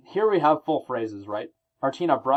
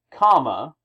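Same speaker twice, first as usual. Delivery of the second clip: loud comma; 8 dB louder than usual. Shown as a demonstration. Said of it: "another full clause".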